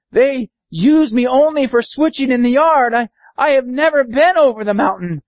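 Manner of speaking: high little-engine voice; little blue engine. Acted.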